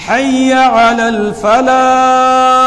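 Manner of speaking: high, loud chant of الفلاح with a pure f and long "aa"; muezzin-style الفلاح, f never turned to "ph"; correct adhan pronunciation. Shown as a demonstration. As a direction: chant حی علی الفلاح slowly, breathy h closing الفلاح. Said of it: "صحیح تلفظ یہ ہے".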